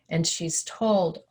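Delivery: ordinary talk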